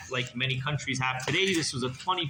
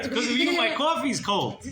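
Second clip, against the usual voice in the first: higher, hammed-up accusation; mock indignant blame; 9 semitones higher, 4 dB louder than usual. A narrator's reading. Rebbe blames the boy for cold coffee, mock indignant. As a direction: lay comic blame in a raised pitch.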